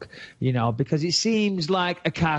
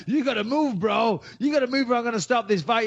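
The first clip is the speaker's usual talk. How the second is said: imitated referee shout; 8 semitones higher than usual. Referee warning a fighter he must move, shouting high. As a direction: yell it like a ref about to stop the fight.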